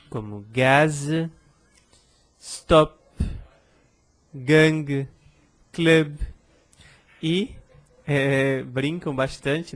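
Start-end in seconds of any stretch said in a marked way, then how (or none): 0.5-6.2 "gaz, stop, gang, club", each last consonant sounded; one loud word at a time, release the final z, p, g, b; consonnes finales prononcées. Shown as a demonstration. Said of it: "eu vou pronunciar".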